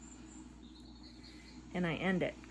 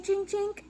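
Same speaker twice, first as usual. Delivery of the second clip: imitated clink; 13 semitones higher, 5 dB louder than usual. She mimics the clink she heard while napping, high and tinny. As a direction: make the tinkling noise of metal falling.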